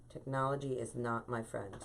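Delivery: a rueful aside under her breath; muttered repeat. Real, not acted. Host grumbling to herself about the stream, muttered. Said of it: "repeats the complaint".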